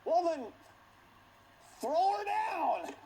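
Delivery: loud, high, slow order; commanding king. Retold or imitated. Jehu ordering the servants below, slow loud command. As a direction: bark the order down from the chariot. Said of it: "So he says".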